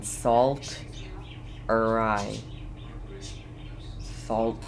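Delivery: ordinary delivery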